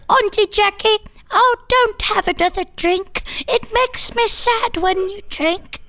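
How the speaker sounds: high, sweet little dog voice; a tiny worried pup pleading; Wendy voice. Retold or imitated. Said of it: "That's my Wendy voice".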